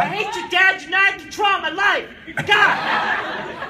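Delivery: hammed-up teenage outburst; mock teen tantrum. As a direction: yell at dad like a rebel teen. Acting teen rebelling.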